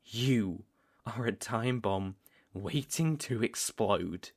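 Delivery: ordinary talk, nothing marked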